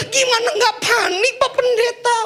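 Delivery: high, laughing, whiny wife voice; complaining wife. Acted. A wife complains to the pastor about her husband, high and whiny. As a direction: plead with the pastor, exasperated. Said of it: "Lalu istrinya ngomong".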